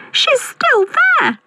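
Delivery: very high, slow cub squeal; tiny squeaky voice. A narrator's reading. Baby Bear spotting Goldilocks in bed, drawn-out squeak. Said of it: "squeaked Baby Bear in his tiny voice".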